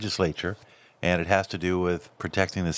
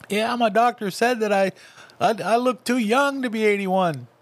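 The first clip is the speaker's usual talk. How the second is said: much higher put-on old man voice; mock Biden voice; 12 semitones higher than usual. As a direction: parody him, high and strained. Mocking Biden's words.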